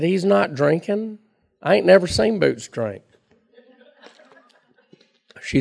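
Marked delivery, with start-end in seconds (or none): 0.0-3.0 protesting son. Retold or imitated. Son protests to his mother.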